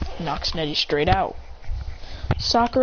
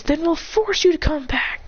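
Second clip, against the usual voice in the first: high-pitched angry threat; angry bark; 8 semitones higher than usual. A narrator's reading. Kiba threatening to force someone back, angrily barking. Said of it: "Kiba says angrily, barking out".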